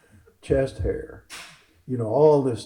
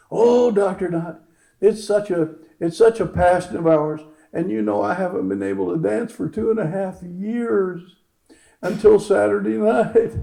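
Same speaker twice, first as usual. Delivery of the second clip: high, loud put-on patient's voice; acted patient's heartfelt revelation, high; imitated gushing patient; 7 semitones higher, 4 dB louder than usual. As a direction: pitch it up and gush as the patient.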